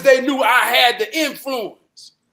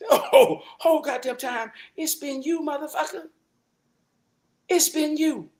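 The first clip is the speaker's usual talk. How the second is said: knowing accusation; 5 semitones higher than usual. Retold or imitated. Mock-realizing who the snitch was.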